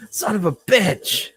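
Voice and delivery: high, drawn-out comic curse; mock pained yell. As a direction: yelp it like a workman with a hurt foot. Workman drops wrench on foot.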